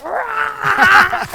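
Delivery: a high, loud growling roar; mock roar. Dad tearing off a branch, mock roar. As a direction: roar as you tear the branch off. Acting out him ripping branch.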